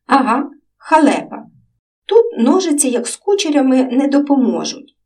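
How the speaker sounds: lightly voiced children chatting; child voices in dialogue. A narrator's reading. Girl and boy chatting about dreams, lightly voiced children.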